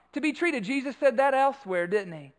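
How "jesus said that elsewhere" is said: ordinary talk, nothing marked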